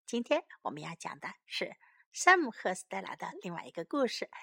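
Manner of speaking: ordinary delivery, nothing marked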